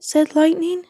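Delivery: plain reading voice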